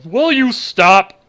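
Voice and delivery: very slow, drawn-out exasperated announcer; Gorilla Monsoon impression. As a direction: plead it like an old ringside announcer. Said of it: "Get some Gorilla Monsoon going".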